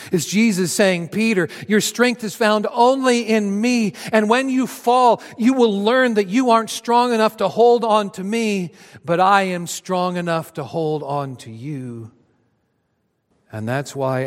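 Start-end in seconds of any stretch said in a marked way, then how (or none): 1.1-12.1 higher, louder rising fervour; fervent promise. Acted. Jesus fervently promising Peter he will hold him. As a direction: build the promise with passion.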